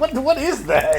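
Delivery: laughing squeal. Real, not acted. Reacting to slime laughing.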